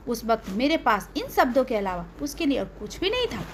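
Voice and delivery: plain reading voice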